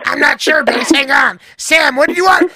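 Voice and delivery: high, loud exasperated calling; hammy mother juggling two callers; flustered wife voice. Acted. Acted wife arguing.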